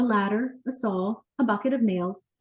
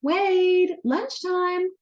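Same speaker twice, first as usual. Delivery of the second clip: high, loud call across the yard; 10 semitones higher, 4 dB louder, much slower than usual. A narrator's reading. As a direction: call him in from the house. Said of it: "his mom called from the back".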